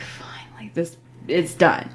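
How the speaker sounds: breathless relieved whisper. Retold or imitated. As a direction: let it out under your breath, spent. Relief after exploding into tears.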